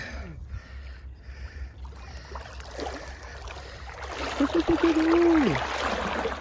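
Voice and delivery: high airy laughter; long breathy laugh. Real, not acted. Laughing at his friend's luck.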